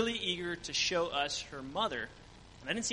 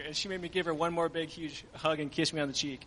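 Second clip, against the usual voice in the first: ordinary talk, nothing marked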